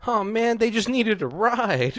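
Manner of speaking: high, loud mock pleading; hammed-up desperate whine to be spared; begging for his life. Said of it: "begging for his life".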